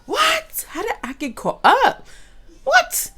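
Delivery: high, loud cries of disbelief; stunned disbelief. Retold or imitated. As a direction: yelp it like you can't believe you got caught.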